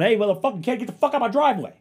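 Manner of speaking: high, very loud chew-out; angry yell. Pretend. Neighbor imagining telling off a kid in his driveway, angry yell. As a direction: yell it like you mean to scare them off.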